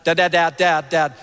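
loud, rapid-fire repeated calls; pestering kids. Acted. Dad imitates his kids calling him, insistent pestering. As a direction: imitate kids nagging Dad without a break. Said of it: "They just come and they're like".

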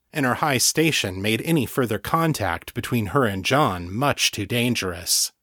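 usual speaking voice

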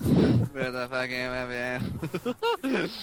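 very slow, words stretched out; slow robotic drawl. Acted. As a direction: drag each word out like a machine. Echoing robot bit slowly.

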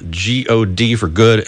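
G O D spoken letter by letter, slowly; spelling it out. Shown as a demonstration. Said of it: "It's spelled Godt".

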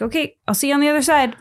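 quick, high, mock-casual goodbye; breezy send-off. God to the dying person, breezy send-off. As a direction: toss it off lightly, pitched well up.

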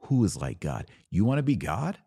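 ordinary talk, nothing marked

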